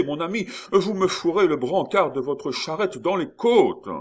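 high, loud, flustered complaints; distressed shouting. A narrator's reading. Friard jostled by animals and carts, distressed shouting. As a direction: cry out, losing your head in a crush. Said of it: "du ton de détresse d'un homme qui commence".

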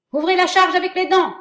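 high, loud battle orders; shouting commands. Acted. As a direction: bark orders to the troops. Officer commanding troops.

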